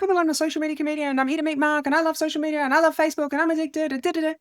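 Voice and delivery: high, fast gushing rush; mock frantic over-eager pitch; nervous eager babble. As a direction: rattle it off like an overexcited fan. Babbling to the comms guy.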